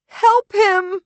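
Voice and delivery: very high, loud plea; crying out. Acted. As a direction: cry out desperately for help. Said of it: "she cries".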